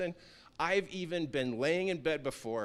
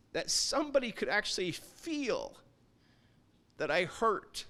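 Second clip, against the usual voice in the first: retold hurting confession. Hurting stranger tells the man who stopped him, halting retold confession.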